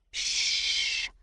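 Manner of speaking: breathy unvoiced hush; whispered shush. A narrator's reading. Mars hushing Bernie so no one hears, a whisper. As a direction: hush the room without voice. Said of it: "Mars whispered to Bernie".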